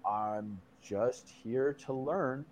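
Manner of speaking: very soft, slow and low; meek reply. Retold or imitated. Tripper pleading with the minotaur, slow meek hush. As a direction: answer the monster haltingly, hushed and low.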